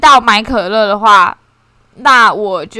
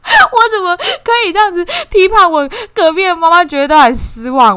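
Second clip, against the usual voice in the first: laughing; 8 semitones higher, 4 dB louder than usual. Real, not acted. Laughing at her own meanness.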